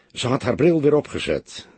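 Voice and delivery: ordinary delivery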